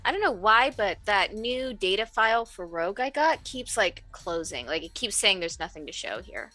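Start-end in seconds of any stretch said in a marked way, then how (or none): none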